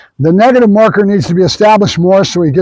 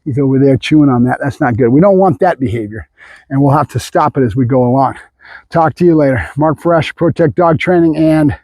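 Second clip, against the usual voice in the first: ordinary talk, nothing marked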